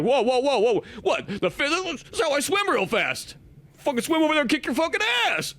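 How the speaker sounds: indignant merman showing off; defensive Namor. Retold or imitated.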